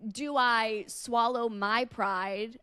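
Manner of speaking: ordinary talk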